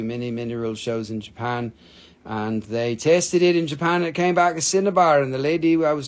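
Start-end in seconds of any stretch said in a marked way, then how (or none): none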